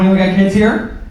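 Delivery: ordinary talk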